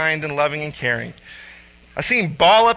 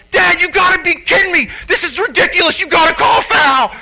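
high, loud, outraged shout; furious kid; 10 semitones higher, 6 dB louder than usual. Retold or imitated. Son screaming at his dad to call the foul, furious. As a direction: yell like a kid ready to explode. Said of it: "I'm gonna kill somebody right now kid".

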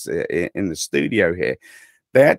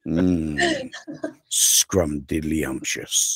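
low, drawn-out savouring; slow relish; 5 semitones lower, 4 dB quieter, much slower than usual. Pretend. Cohost savours his own accent for a fan, slow relish. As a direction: stretch the word out as if tasting it.

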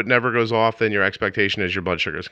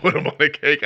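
laughing echo of the joke; amused laughing repeat; 6 semitones higher than usual. Real, not acted.

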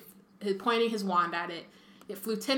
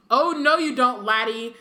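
put-on loud, raised roar at a boy; roaring rebuke; 4 semitones higher, 8 dB louder than usual. A narrator's reading. Moody catches a student attacking from behind, roaring. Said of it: "a roar that echoed".

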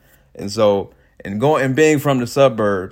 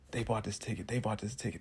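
hushed aside; 17 dB quieter than usual. Retold or imitated. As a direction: tell it under your breath. Telling friend quietly, repeated.

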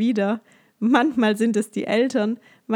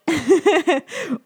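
loud laugh; 6 semitones higher, 5 dB louder than usual. Real, not acted. Laughs after the mouse voice.

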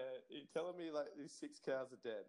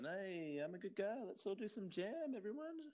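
higher-pitched sales pitch, climbing as it goes; chummy campaigner; 7 semitones higher than usual. Acted. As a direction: pitch jam to the crowd in a put-on high voice. Voices the candidate campaigning with jam.

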